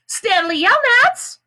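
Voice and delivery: a long, high, loud call. A narrator's reading. The lawyer calls to Stanley, long, high and loud. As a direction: call his name across the lake. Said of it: "she called".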